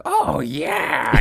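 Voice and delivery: put-on high-pitched drawn-out baby wrestler cry; Ric Flair as baby. Shown as a demonstration. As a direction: do a cartoon baby version of a wrestler. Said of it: "It was Ric Flair as a baby".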